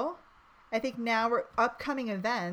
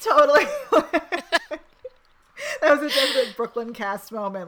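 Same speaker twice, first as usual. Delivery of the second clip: loud and higher, laughing through words; laughing loudly; 3 semitones higher, 9 dB louder than usual. Real, not acted.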